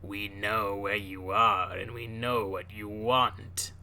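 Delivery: slow, gravelly phone menace; spooky gravelly voice. Acted. Sinister caller threatening someone over the phone, spooky and gravelly. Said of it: "in a spooky, gravelly voice".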